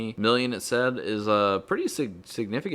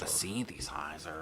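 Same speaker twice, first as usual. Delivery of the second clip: slow, hushed, lower mimicry of Moroder's accent; [name] accent; 6 semitones lower, 12 dB quieter than usual. Co-host echoing the host, imitating [name]'s accent. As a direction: draw it out softly in the producer's accent. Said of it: "[name] calls it".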